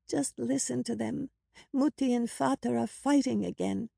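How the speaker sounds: put-on small, high child's voice, hushed; hushed, fearful little sister; frightened whisper. A narrator's reading. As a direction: speak softly and high like a scared little girl. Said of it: "Anka whispered in a frightened voice".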